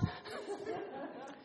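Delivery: soft airless laugh at himself; breathy laugh. Real, not acted. After wife's answer.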